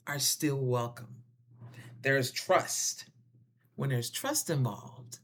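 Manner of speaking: ordinary talk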